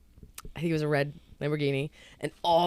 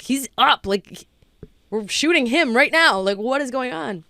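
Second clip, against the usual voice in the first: higher, louder, urgent retold complaint; exasperated crew; 7 semitones higher, 6 dB louder than usual. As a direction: imitate a film crew losing patience. Crew exasperated at missing actor.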